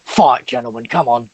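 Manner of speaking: lightly voiced orc ringleader egging on the fight; hint of a rowdy fight host. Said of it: "It's like".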